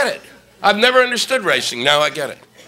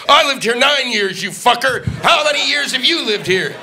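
mock furious local yelling in traffic; angry driver; 7 semitones higher than usual. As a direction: bark it like an enraged neighbour, higher.

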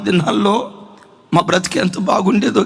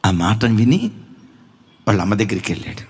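normal talking voice

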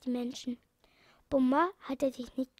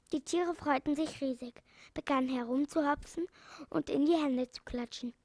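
usual speaking voice

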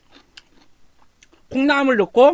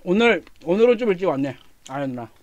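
speaking between bites; talking while chewing; 3 semitones lower than usual. Real, not acted. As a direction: mouth partly full of food. Seen 0: Cheeks look puffed with food while he talks after a bite.

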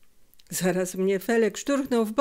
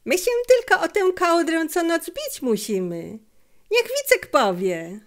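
put-on much higher, louder boyish cry, slowing at the end; boy shouting; 9 semitones higher, 5 dB louder than usual. A narrator's reading. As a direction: protest eagerly at full voice. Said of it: "krzyknął Felek". (głośny, wysoki okrzyk chłopca do ojca)

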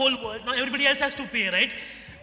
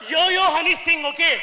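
loud hype; 4 semitones higher, 9 dB louder than usual. Acted. Trump card in contest.

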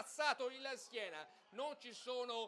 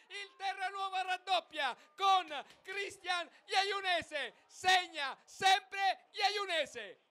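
screaming goal call, high and loud; breathless italian goal commentary; 11 semitones higher, 18 dB louder, slower than usual. Real, not acted. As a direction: roar the second goal. Second goal scored.

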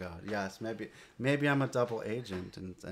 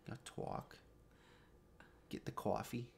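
New Jersey accent; 12 dB quieter than usual. Shown as a demonstration. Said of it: "do a little better New Jersey affectation".

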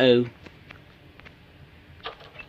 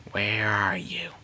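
slow whisper; 6 semitones lower, 10 dB quieter, slower than usual. Real, not acted. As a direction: whisper it, hunting. Hiding from enemies.

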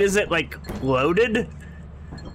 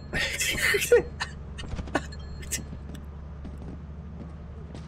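high wheezy laugh; 9 dB quieter than usual. Real, not acted. Laughing at the present.

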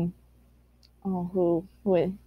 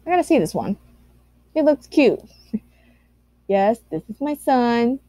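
ordinary delivery

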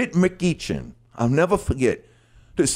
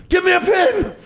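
high, loud shouting for a pen; excited kid yell; 4 dB louder than usual. Retold or imitated. Boy running through the house begging for a pen, shouting. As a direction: yell it like a kid who can't wait. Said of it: "That's me running through the house".

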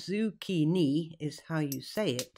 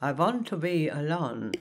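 put-on Garbo-like accent. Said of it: "protested Greta".

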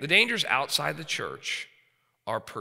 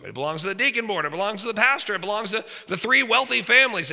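rising indignation; 7 semitones higher, 5 dB louder than usual. Real, not acted. Building list of false owners.

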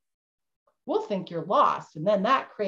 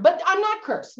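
defensive denial; 8 semitones higher than usual. Pretend. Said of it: "defensive denial".

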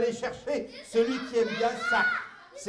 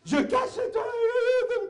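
showy announcement; 12 semitones higher, much slower than usual. Acted. Clown shows trick to kids.

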